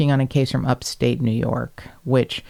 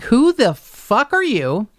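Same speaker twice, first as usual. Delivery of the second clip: upset; 7 semitones higher than usual. Retold or imitated. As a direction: demand answers, outraged, pitch leaping up. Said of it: "Melissa was a little upset".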